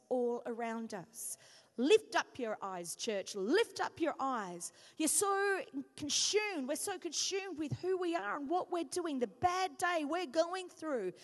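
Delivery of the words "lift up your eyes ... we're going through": slow, loud pulpit exhortation; fervent sermon appeal; preaching. Acted. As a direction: call the church to look up.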